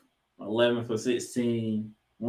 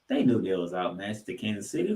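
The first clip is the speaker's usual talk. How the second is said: normal talking voice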